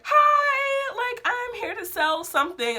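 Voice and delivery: high, singsong mock sales greeting; saleswoman impression. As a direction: chirp it like a pushy saleswoman. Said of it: "Doing their sales pitch".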